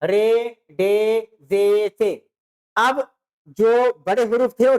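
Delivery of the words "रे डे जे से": रे, डे, जे, से spaced out very slowly; slow letter drill. Shown as a demonstration. Teacher slowly spelling out letters for young students. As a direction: sound out each letter slowly. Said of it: "Urdu भाषा में ज्यादा रखता है".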